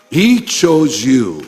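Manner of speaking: P=A; emphatic. Acted. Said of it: "He found you".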